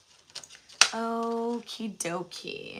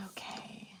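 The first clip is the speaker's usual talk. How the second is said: whisper; 15 dB quieter than usual. Real, not acted. Quiet whisper to self.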